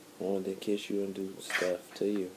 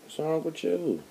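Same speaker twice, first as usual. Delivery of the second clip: mocking girlfriend; 10 semitones higher, slower than usual. Mocking her reply in argument.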